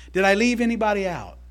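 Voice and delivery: normal talking voice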